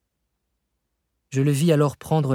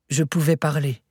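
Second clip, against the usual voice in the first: ordinary delivery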